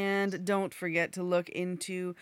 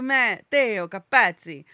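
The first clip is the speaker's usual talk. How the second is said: loud Italian Matteo Capazzi, "ts" in the surname; emphatic Italian-accented full name; exact Italian; 9 dB louder than usual. Shown as a demonstration. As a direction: copy the Italian accent with gusto. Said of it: "be exact to Italian".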